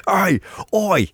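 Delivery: high-pitched mock-British call; fake British accent. Cousin hailing someone, in an exaggerated fake British accent. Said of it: "an American doing a British accent".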